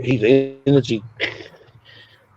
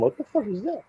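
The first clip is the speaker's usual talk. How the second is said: startled fright; 10 semitones higher, 8 dB quieter than usual. Retold or imitated. Startled by thing on bed.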